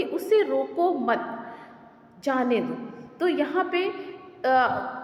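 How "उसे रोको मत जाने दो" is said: "उसे रोको मत" held together, pause, then "जाने दो"; pause after मत. Shown as a demonstration. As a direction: break the phrase after मत. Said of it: "एक छोटा सा एग्जांपल आपके दिया हुआ है". ("मत" के बाद ठहराव)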